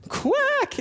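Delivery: put-on very high duck quacks from a crying little girl; high pitched tearful duck quack. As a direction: squeak out quacks like a sobbing child playing a duck. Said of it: "she's standing there going".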